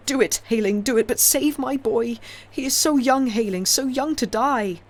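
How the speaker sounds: high, loud pleading shriek; desperate begging. A narrator's reading. Kneeling father begging for his son's life, desperate. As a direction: offer your life for your boy. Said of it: "shrieked the old man".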